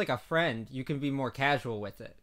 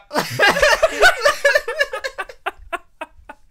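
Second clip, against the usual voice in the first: high, loud burst of real laughter; 11 dB louder than usual. Real, not acted. Host laughs at his own slip on air, loudly. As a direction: crack up way up high.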